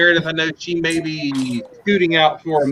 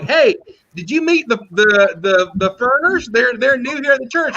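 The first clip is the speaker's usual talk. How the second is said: higher-pitched, friendly small-talk question; eager retold greeting; 7 semitones higher than usual. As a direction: retell a cheery bit of church news to a friend. Retelling a chat with friend.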